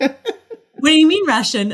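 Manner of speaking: high, loud, slowed laughing disbelief. Retold or imitated. Mother reacting to son choosing Russian, laughing disbelief. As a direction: let the shock spill into a laugh. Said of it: "I'm like".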